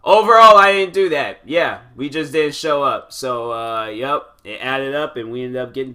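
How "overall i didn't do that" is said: loud, high burst of emphasis; suddenly loud confession; emphatic. Real, not acted. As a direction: blurt it out loudly.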